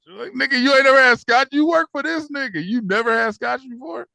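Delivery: high, loud disbelief; real excited outrage, pitched high; incredulous. Real, not acted. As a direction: call him out in disbelief. Mocking disbelief at a character.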